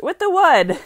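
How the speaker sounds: high, loud, keen finish; eager promise. A narrator's reading. Sailor Lupe promising Nat log tables, eager. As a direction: punch it up high, proudly offering. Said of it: "Lupe promised".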